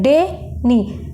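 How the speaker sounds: डे, नी with a pause between; two separate beats, डे then नी; split into syllables. Shown as a demonstration. Teacher showing the break, डे and नी held apart. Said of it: "बीच में हम एक बार break ले रहे हैं".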